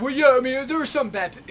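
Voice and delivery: high, loud mock protest; mimicking an opponent. Vlogger replays an online opponent's excuse, mimicking him loudly. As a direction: imitate an arguer butting in defensively.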